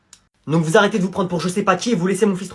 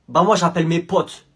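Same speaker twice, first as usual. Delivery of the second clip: ordinary talk, nothing marked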